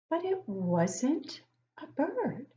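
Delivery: slow, drawn-out storybook reading; reading to children. Acted. Teacher reading a picture book to young kids, slow and storybook-like. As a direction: read it slowly to the class, savouring each line.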